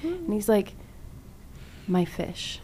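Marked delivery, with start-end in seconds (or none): 1.9-2.6 low, much softer mournful whisper-like; mock mournful. The brother confessing his fish died, mock mournful. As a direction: drop it low and soft like grieving.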